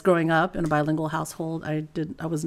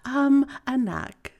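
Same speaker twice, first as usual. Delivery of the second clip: slow, higher Filipino mom voice; imitating mom's Filipino accent; 6 semitones higher, slower than usual. Mom turning to her daughters, imitated Filipino accent. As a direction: call the kids like a Filipino mother. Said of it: "my mom turns to me and my sister and she says".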